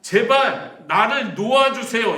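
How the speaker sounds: loud, high, slow mock begging; drawn-out, acted desperate appeal; loud plea. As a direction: cry out to God to let you go. Acting out Job's plea to God.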